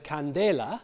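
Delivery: candela with DE stressed, the Latin way; stress on second syllable. Shown as a demonstration. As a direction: lean on the middle syllable of candela. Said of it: "de is the stress syllable".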